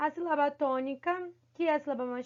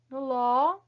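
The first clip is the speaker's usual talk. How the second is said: "no ló" with the open Ó pushed louder; stress on LÓ; 2 semitones lower, 5 dB louder than usual. Shown as a demonstration. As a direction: isolate and punch the syllable in "no ló". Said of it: "tem aí o acento agudo no O". ("no ló" com ênfase no Ó aberto)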